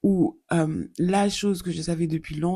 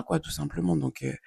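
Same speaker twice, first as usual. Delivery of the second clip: ordinary talk, nothing marked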